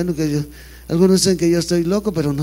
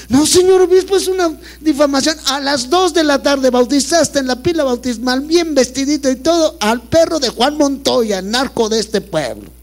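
shrill mock accusation; 9 semitones higher than usual. Cura imita acusación chillona.

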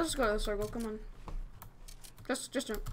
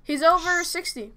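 urgent callout; 6 semitones higher, 8 dB louder, slower than usual. Real, not acted.

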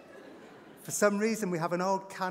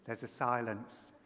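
plain reading voice

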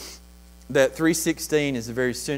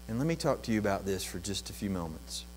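ordinary delivery

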